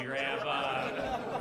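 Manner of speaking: usual speaking voice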